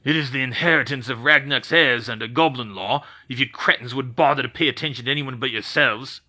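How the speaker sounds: a lightly voiced goblin, bristling; goblin shooting back. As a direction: snap it back like an angry goblin. Goblin Brodig scorns the wizards.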